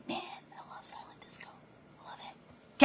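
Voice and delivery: breathy secret whisper; real whispered aside. Real, not acted. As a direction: whisper it like a private confession. Aside about silent disco.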